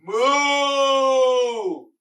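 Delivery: long, loud, high moo; cow moo. Shown as a demonstration. Teacher giving children a clue to the animal, loud cow moo. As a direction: draw out a big, booming cow moo. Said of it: "he makes a real sound. He goes".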